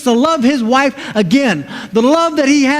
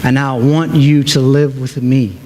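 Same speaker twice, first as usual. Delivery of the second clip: gentle Jesus promising; 9 semitones lower than usual. Acted. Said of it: "And so Jesus says to us".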